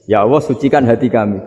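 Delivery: usual speaking voice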